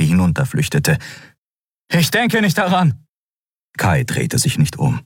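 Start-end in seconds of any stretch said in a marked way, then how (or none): 1.9-3.0 high-pitched, agitated retort; agitated refusal. Acted. Kai fleeing Kero, agitated high refusal. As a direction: throw it back while fleeing.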